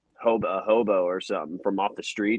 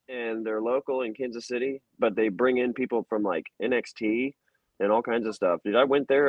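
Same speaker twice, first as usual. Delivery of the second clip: normal talking voice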